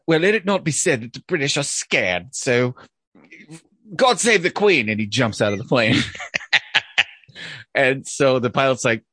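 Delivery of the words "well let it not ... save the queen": a hint of a stiff-upper-lip ambassador; mildly hammed-up patriotic Brit; lightly played proud Brit. British ambassador's patriotic farewell.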